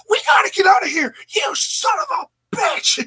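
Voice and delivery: shrieking high commentator, fast then dragged out; Mike Tenay impression. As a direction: scream it like an announcer at the end of his rope. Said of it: "Good impersonation".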